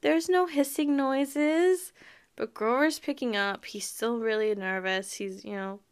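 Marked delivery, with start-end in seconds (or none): 0.0-1.9 higher, slow, falsely sweet brush-off with fake laugh; fake-sweet denial. Auntie Em brushes off Grover's worry with a higher, sugary fake laugh. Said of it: "And Auntie Em is like".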